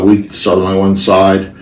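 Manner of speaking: ordinary delivery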